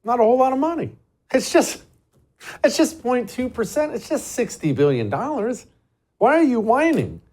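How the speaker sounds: high-pitched mock dismissal; mocking echo. Host mimicking the senator who waves off the cost, mocking. Mockingly echoes the politician's clip.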